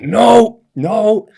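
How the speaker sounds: loud high refusal; manager yelling. Retold or imitated. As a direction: shout no twice.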